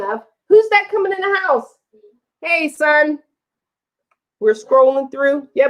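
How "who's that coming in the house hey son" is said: high, raised call. Real, not acted. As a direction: call out to him. Calling to son arriving.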